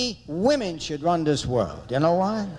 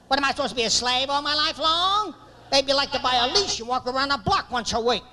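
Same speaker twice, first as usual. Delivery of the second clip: high, indignant harangue; screaming wife; 7 semitones higher than usual. Retold or imitated. Wife accusing husband of enslaving her, screaming. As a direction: imitate an outraged wife in full complaint. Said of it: "She's screaming".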